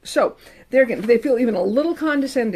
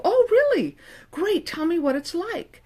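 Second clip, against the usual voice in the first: eager openness; 7 semitones higher than usual. Pretend. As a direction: play the curious, humble therapist. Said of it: "And they go".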